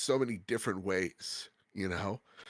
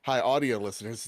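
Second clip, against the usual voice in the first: normal talking voice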